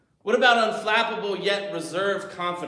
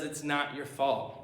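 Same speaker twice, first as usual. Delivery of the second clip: gentle reassurance; 3 semitones lower, 6 dB quieter than usual. Retold or imitated. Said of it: "and says".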